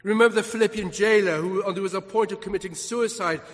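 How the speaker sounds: loud, building pulpit proclamation; impassioned preaching. Real, not acted. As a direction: build to a ringing call to believe. Preaches the call to believe.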